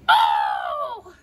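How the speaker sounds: sudden, very high "oh" cry; high scream. Pretend. Child protesting in play, high scream. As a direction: shriek it out on a high note. Scream before telling to stop.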